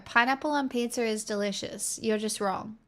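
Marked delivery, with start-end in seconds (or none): none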